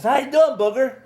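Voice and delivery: high-pitched taunting hello; needling greeting. Retold or imitated. Young man provoking the man who hates his nickname, needling.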